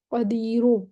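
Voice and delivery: قدیرو ending with a faint u sound after the ر; rawm stop. Shown as a demonstration. Teacher showing the right way, faint u on the ر. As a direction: let a trace of u sound on the ر of قدیرو. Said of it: "تھوڑی سی رو، او".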